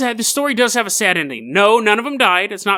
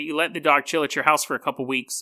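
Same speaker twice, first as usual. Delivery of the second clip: ordinary delivery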